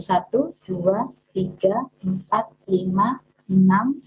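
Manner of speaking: counts the taps out slowly, one by one; slow, steady count, as if tapping along. Shown as a demonstration. Teacher counting taps slowly for viewers. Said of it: "sudah berapa nih?".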